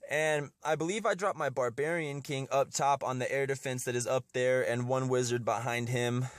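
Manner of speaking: ordinary delivery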